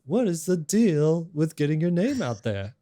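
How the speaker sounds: high, loud observational stand-up comic voice; Jerry Seinfeld impersonation. Acted. Podcast host reading out a listener's question, Jerry Seinfeld impersonation. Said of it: "That's my best Jerry Seinfeld, uh, impersonation".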